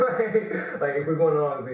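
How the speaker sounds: bursting into high laughter; cracking up at the memory; laughing hard, high and loud. Real, not acted. Laughing at the story.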